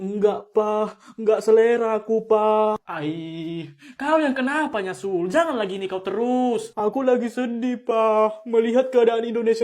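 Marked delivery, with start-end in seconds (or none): none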